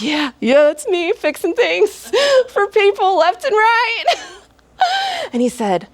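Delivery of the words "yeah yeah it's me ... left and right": high, giggly bluster; nervous laughter. Acted. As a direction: laugh off her nerves while bragging. Addie tries to sound casual.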